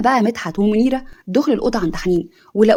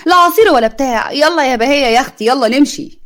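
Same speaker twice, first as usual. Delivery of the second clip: indignant refusal; 3 semitones higher than usual. Acted. Offended aunt refuses juice, leaving.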